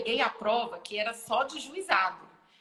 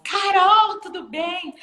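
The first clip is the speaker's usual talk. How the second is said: excited greeting; 10 semitones higher, 10 dB louder, much slower than usual. Retold or imitated. Friend greets her eagerly.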